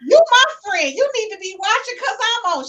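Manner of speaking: high, loud mock scolding; playful retort. Host retells scolding her college friend, high and loud. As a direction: fire it back at her friend, playing it up. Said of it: "she retells her reply".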